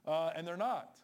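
normal talking voice

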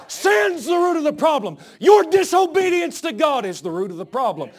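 shouted high preaching; preaching at full force. Real, not acted. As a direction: cry it out from the pulpit.

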